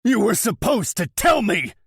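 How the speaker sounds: roar. Acted. Betrayed brother roars at Yuri, furious shout. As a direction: roar it in his face. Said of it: "I roar".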